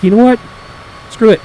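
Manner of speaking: mock defiant outburst; fed-up retort. To the park ranger, mildly heated and defiant.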